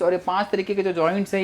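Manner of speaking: ordinary talk, nothing marked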